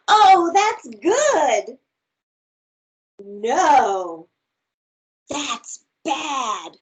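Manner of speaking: lively, expressive storybook read; reading to children. Acted. Teacher reads a picture book aloud to her class, bright and expressive. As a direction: read it big and playful for little listeners.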